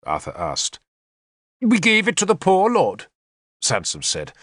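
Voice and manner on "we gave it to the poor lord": high, plaintive bishop voice; bishop pleading innocence. A narrator's reading. Bishop excusing himself to his warlord, high-pitched pleading. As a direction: explain yourself in a raised pitch. Sansom defends himself to Arthur, narrated dialogue.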